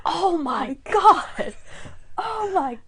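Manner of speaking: high, drawn-out imitated cry of delight; overjoyed awe. Host acts out Monk seeing the organized shed, ecstatic awe. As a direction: stretch it out, hammed-up amazement. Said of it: "his reaction was so funny".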